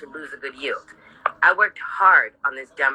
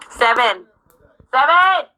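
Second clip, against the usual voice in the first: loud call, pitched way up; sharp rising shout of a number; 10 semitones higher, 5 dB louder, slower than usual. Real, not acted. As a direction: call across the house to get someone moving. Calling someone off-mic.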